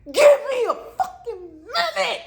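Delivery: high, slow, exasperated through tears; tearful snap. Retold or imitated. Stranded traveler snapping at the security guard, tearful. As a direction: plead and snap at once. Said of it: "and I said".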